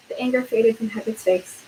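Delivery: ordinary delivery, nothing marked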